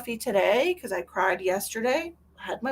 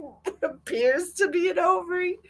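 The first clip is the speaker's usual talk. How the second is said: higher, incredulous mocking quote; 8 semitones higher than usual. Ridiculing the doctor's report wording, in mock disbelief. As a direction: scoff at the report's phrase. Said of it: "What do you mean".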